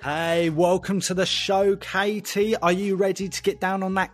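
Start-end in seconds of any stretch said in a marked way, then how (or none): none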